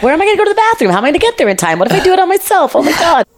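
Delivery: high, frantic mock panic; hammed-up high-pitched bathroom panic; panicked inner voice. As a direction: squeal it out like you're about to soil yourself. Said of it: "I'm like".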